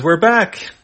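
high, loud cheerful shout; big happy homecoming cry; excited greeting. Acted. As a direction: burst in thrilled to be home. Returning heroes greet friends.

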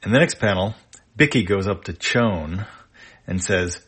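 plain reading voice

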